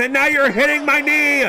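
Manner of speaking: outraged shout. Acted. Complaining mid-fight.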